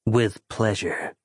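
a smooth, unhurried villain doctor; Doctor Valesco. Acted. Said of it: "said Doctor Valesco".

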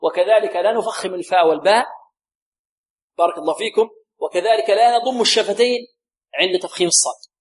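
usual speaking voice

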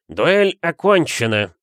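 high, loud, slow declaration; formal announcement. A narrator's reading. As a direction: proclaim the end of a duel. Second announces the duel's end. (громкое торжественное объявление)

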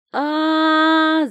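high, loud startled scream; shocked scream. A narrator's reading. Girl screaming in shock at the stranger's eyes. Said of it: "закричала я от шока".

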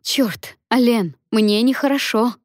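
high, pained indignant outburst; moaned outburst. A narrator's reading. Labouring Lia rebukes Alen for saying all is well, moaning indignantly. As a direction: start on a sharply rising question, then exclaim. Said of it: "простонала она".

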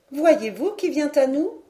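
put-on a young lady pointing out a rider; young lady asking.

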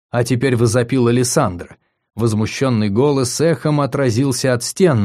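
plain reading voice

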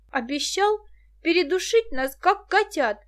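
high, loud outrage; outraged on behalf of kittens; indignant. A narrator's reading. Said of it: "возмутилась помешанная на кошках Муся".

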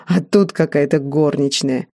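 ordinary delivery

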